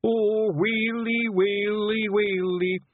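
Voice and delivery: high, drawn-out comic wail; keen slowly in hammed-up Scots sorrow; wailing grief. A narrator's reading. Simpleton mourning his leader, theatrical sobbing wail. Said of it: "he sobbed".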